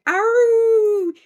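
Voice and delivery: high, loud drawn-out howl; werewolf howl. Retold or imitated. Showing a friend the sensor werewolf, loud imitated howl. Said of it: "they were doing it".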